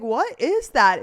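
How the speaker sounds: loud and slow "What is that?"; startled question. Retold or imitated. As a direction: ask it like you can't believe your eyes. Retelling her shock.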